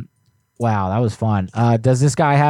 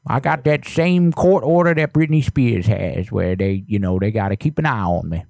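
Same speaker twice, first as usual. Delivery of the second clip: put-on creaky old neighbor, fussy and nosy; old man voice. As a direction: play a suspicious grumpy grandpa. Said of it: "anytime I use that old voice".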